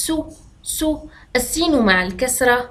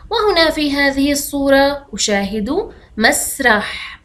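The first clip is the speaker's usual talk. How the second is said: normal talking voice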